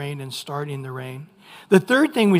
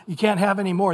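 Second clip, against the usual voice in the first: quick mock complaint; faster than usual. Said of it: "it's like".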